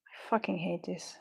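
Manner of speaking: a voiceless whispered curse under the breath; frustrated whisper. Real, not acted. Alone with the beads, cursing at them in a whisper. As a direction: whisper it to yourself, fed up.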